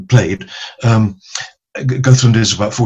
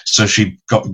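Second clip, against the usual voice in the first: normal talking voice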